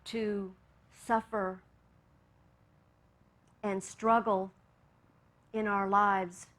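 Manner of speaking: hushed meditation voice drawing to an amen; guided meditation. Acted. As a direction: ease them gently back into the room. Closing meditation and prayer.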